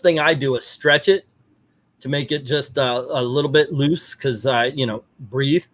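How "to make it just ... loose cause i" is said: normal talking voice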